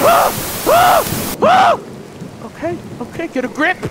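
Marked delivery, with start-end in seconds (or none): 0.7-1.8 piercing high screams; terrified scream. Real, not acted. Gamer caught by a monster, screaming high and loud. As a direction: scream in pure fright.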